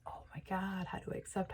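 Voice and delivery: hushed, almost under the breath; very soft, wondering to yourself; overwhelmed aside. Acted. You unsure how to accept the gift, hushed and overwhelmed. Acted hesitation at accepting the money.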